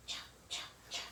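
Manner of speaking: breathy whispered chop chop of shoes; soft footsteps. Retold or imitated. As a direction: whisper the steps of quiet shoes. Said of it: "They make soft sounds".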